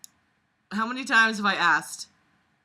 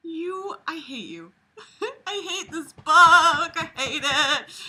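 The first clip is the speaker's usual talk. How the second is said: high-pitched whine building louder; exasperated whine; 8 semitones higher, 7 dB louder, slower than usual. Real, not acted. Reader telling the book they hate it, exasperated whining. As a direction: whine it through laughter, drawing out the end.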